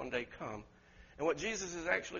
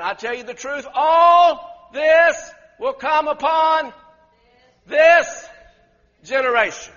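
much louder, higher, slow prophetic cry; proclaiming loudly; 9 semitones higher, 17 dB louder, slower than usual. Retold or imitated. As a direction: thunder each word to the crowd. Jesus's prophecy proclaimed at full force.

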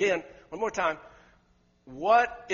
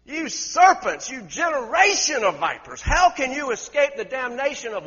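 fiery rebuke; 7 semitones higher, 12 dB louder than usual. Retold or imitated. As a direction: roar it like the lion. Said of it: "He's hot. He's the lion".

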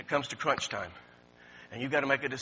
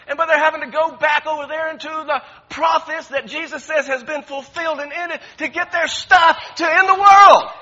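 building to a loud, higher shout; rising into a shout; 12 semitones higher, 16 dB louder than usual. Real, not acted. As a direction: preach it louder until you are shouting.

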